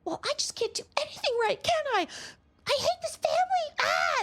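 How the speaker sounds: high hammed-up teen tantrum ending in a cry; dramatic teenager. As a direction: wail it like a sulking teenager storming off. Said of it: "they're like".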